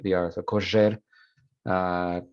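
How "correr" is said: correr said korezher; assibilated R. Shown as a demonstration. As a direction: buzz the rr in correr like zh. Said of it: "the zh sound for the R".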